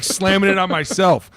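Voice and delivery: ordinary delivery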